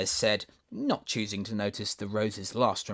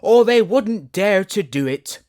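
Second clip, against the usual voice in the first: agitated flower; 11 semitones higher, 13 dB louder, slower than usual. Acted. Tiger lily still agitated.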